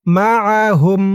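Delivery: মাআহুম chanted in one connected breath; joined recitation. Shown as a demonstration. Teacher recites word joined.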